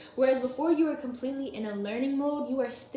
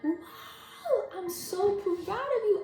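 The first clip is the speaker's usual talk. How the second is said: voicing inner cheers; 9 semitones higher, much slower than usual. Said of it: "There are cheers going on in my head".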